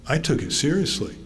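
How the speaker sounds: ordinary delivery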